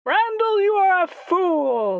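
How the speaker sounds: indignant high yell; angry shout. Acted. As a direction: scold the fool sharply. Guardian scolds player.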